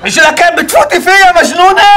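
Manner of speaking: high-pitched outraged yell; exasperated scolding. Acted. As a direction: scold the driver in disbelief. Instructor scolding driver for the crash. (توبيخ غاضب بصوت عالٍ)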